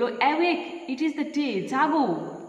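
crying out. Shown as a demonstration. Teacher acting the wind crying awake, loud call. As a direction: ring it out to wake everyone. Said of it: "চিৎকার করে বলল".

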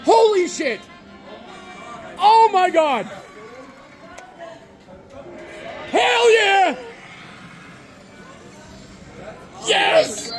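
very high, shouted celebration; ecstatic shouting. Real, not acted. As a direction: yell with pure joy. Celebrating grand jackpot.

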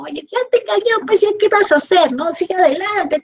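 high, quick cheerful encouragement; upbeat pep talk. Retold or imitated. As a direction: cheer up a sulking friend, brightly. Encouraging a sad friend.

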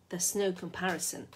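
ordinary delivery, nothing marked

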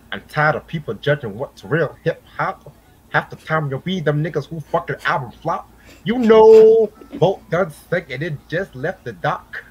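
high-pitched, hammed-up [name] rap delivery; [name] impression. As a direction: recite the bars pitched well up, punching out "you know". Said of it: "Is that your best [name] impression?".

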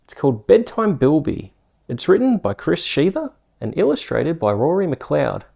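ordinary delivery, nothing marked

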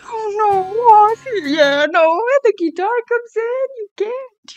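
high, drawn-out wail; tearful reaction. Real, not acted. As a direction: wail it, close to tears. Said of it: "Make me cry and shit".

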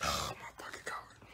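whispered in frustration; unvoiced whisper of disbelief; exasperated whisper. Real, not acted. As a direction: breathe it out as a fed-up whisper. Nails keep popping off.

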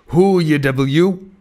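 startled cannibal challenging the intruder; cannibal's challenge. A narrator's reading. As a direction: bark the question at the stranger in the dark. Cannibal wakes, confronts narrator.

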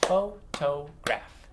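"Photograph" slowly broken into three beats; "Photograph" as separate, evenly spaced syllables. Shown as a demonstration. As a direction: clap out "Photograph" with gaps between syllables. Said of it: "I'm gonna clap out the syllables".

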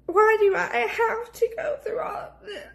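crying through every word; snotty crying. Real, not acted. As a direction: voice breaking, high and teary. Tearful talk about infertility.

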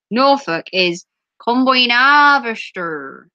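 slow, broad Norfolk drawl; proper Norfolk accent. Shown as a demonstration. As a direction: stretch it out like a Norfolk farmer. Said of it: "that's how Norfolk people talk".

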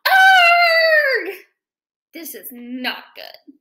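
lively picture-book read-aloud; reading to children. A narrator's reading. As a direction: read it to a class of kids.

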